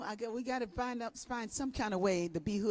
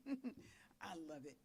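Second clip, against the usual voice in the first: ordinary talk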